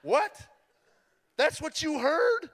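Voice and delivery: can't believe what she heard; stunned disbelief. Retold or imitated. Stunned by wife's reply.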